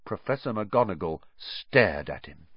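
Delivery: plain reading voice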